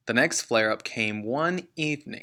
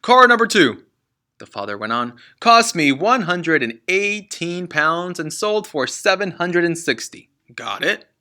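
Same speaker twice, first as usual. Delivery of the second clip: booming, impatient reading of numbers; loud dictating father; 6 semitones higher, 9 dB louder than usual. Acted. Father reading car profits to his son. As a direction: rattle off the sums at him.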